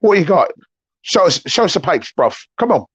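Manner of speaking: teasing imitation of young Londoners; London youth accent. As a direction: ham up the Jamaican-Cockney slang. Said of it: "I sound like an old curmudgeon now".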